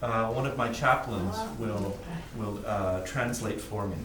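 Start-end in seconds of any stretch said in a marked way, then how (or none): none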